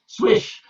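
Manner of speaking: high whooshing swish. A narrator's reading. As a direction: swoosh like leaves. Leaves on the roof.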